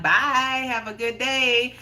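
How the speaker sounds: lifted sing-out farewell; retold called goodbye. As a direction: call a goodbye across to someone.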